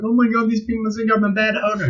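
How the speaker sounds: high, panicked mock owner; flustered owner. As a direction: squeal it like an embarrassed owner. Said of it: "I know you feel like".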